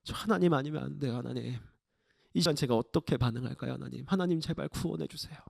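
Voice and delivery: soft, low pleading; desperate hushed prayer. Acted. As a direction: beg God quietly for rescue. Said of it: "모세는 하나님께 기도해요".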